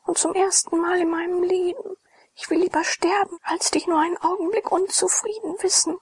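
put-on high, whispered, tearful girl's plea; a young girl begging forgiveness through tears, hushed and high; tearful girl pleading. A narrator's reading. Girl begs Glaukus for forgiveness, high tearful whisper.

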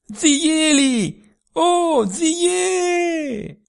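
high, long calling shout across the valley; girl calling out to a worker far below, at full voice. A narrator's reading. Girl calls down the valley, loud, high, drawn-out. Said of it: "con quanta voce aveva in gola, gridò".

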